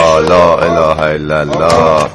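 low, drawn-out plea for patience; slow, low, fed-up chant under pressure; exasperated invocation. Acted. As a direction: pray for patience, slowly. Clerk holding back his temper.

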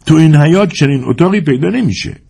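ill-willed Tatar man. A narrator's reading. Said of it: "نگاهی بدخواه به حیاط همسایه افکند و گفت".

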